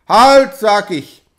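very loud, slow commanding yell; angry shout. A narrator's reading. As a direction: bark the order and hold it. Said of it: "schrie Hauke wieder". (wütender Befehl)

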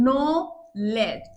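"Knowledge" very slow, split as নো, লেজ; two separate beats. Shown as a demonstration. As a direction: break "knowledge" into two drawn-out syllables. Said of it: "দুইটা ঝুঁকে উচ্চারণ করছি". ("Knowledge" দুই ঝোঁকে, টেনে টেনে)

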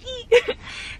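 short high-pitched giggle. Real, not acted. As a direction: giggle at own joke. Laughs after taco joke.

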